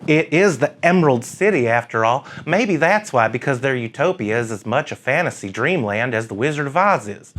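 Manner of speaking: mock the Seattle crowd like a fussy church lady, lightly put on. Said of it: "Let me try out my church lady impression".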